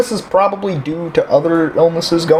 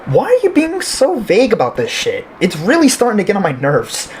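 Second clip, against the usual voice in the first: high-pitched, frustrated demand; indignant, voice pitched up; exasperated; 7 semitones higher than usual. Acted. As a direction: demand answers, at the end of your patience. Patient confronts clinician withholding answers.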